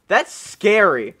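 ordinary delivery

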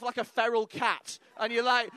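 usual speaking voice

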